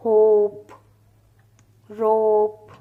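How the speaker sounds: होप and रोप said loud with the ओ held long before the p; long drawn vowels. Shown as a demonstration. Teacher drilling long-vowel words for the class. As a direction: stretch the ओ in होप and रोप, then close on p. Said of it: "लंबी खींचेगी sound".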